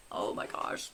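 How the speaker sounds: ordinary delivery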